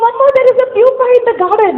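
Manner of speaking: mock-excited child calling Mama. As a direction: replay the son bursting with the news. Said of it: "He comes back with a lot of excitement".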